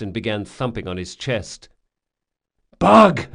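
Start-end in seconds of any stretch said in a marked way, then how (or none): 2.8-3.3 high, loud gasping cry of alarm; gasped shout. Acted. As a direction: gasp out the name in panic. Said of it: "he gasped".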